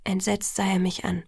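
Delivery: ordinary delivery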